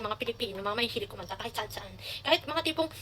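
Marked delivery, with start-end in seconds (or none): none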